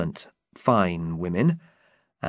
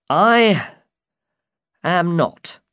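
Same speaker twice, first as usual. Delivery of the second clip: high, mildly sad knight; high-pitched, understated sadness; 8 semitones higher, 4 dB louder, much slower than usual. A narrator's reading. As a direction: admit it with a touch of sorrow. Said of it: "said sadly through his helmet".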